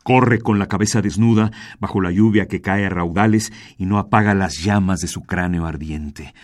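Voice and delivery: ordinary delivery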